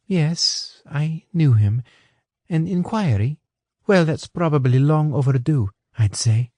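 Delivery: a hint of a polite English woman; Anna. A narrator's reading. Anna explaining to Johnson, lightly voiced.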